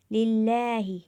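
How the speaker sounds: लिल्लाही chanted, holding the ला for one alif's length; Quranic recitation, stretched. Shown as a demonstration. Teacher reciting लिल्लाही to students, ला stretched. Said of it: "एक अलिफ के बराबर खींचकर पढ़ेंगे".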